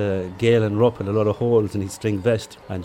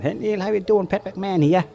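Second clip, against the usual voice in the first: imitated Boston accent, pitched much higher; Pat McMahon's Boston accent; 11 semitones higher than usual. Retired teacher greeting Neil before the race, imitated high Boston accent. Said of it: "in his great Boston accent".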